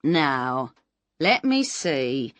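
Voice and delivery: slow, drawn-out musing; slowly. Acted. Mister Bitham stares at Miss Moss, a slow musing voice. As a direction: take your time, stalling. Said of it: "said Mister Bitham slowly".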